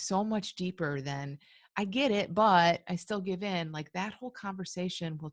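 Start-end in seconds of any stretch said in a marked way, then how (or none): 1.8-3.7 louder imitated "yes, but" objection; mock protest. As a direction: protest loudly as the unconvinced client. Repeating the listener's objection.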